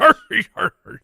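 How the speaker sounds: high, loud barker call; goofy carnival barker. Pretend. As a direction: hurry hurry like a barker. Goofy interjection.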